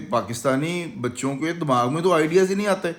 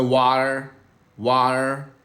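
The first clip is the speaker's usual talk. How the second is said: वाटर in American English, flapped t and r at the end; वाटर, American pronunciation demo; American water; 3 semitones lower, much slower than usual. Shown as a demonstration. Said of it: "American बोलता है".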